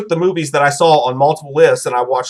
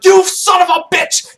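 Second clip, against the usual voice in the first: high, slow, drawn-out yell; wounded soldier bursting out angrily; mock angry outburst; 12 semitones higher, slower than usual. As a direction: spit it at your buddy. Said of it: "he pops up and he's like".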